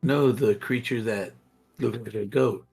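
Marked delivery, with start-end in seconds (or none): none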